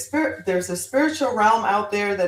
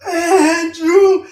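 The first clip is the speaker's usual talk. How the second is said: high, loud howling cry; howled name; 10 semitones higher, 10 dB louder than usual. Retold or imitated. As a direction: howl the name like a pack of dogs. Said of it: "they all howled in the same".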